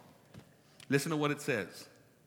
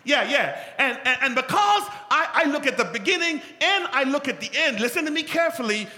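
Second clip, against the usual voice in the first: loud, high, slowed shouting sermon; preaching at full force; 13 semitones higher, 11 dB louder than usual. Acted. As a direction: drive the point home at full force.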